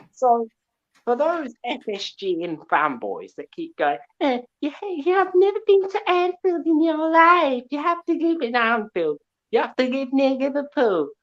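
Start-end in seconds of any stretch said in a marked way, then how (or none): 4.2-11.1 high, loud mocking whine; mock fanboy taunt. Mimicking fanboys who tell him he's never been to Anfield, in a high, loud mocking whine. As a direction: sneer it back like a rival fan.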